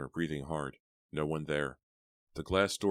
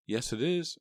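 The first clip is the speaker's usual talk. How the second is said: phone answer; 10 semitones higher, 3 dB louder than usual. A narrator's reading.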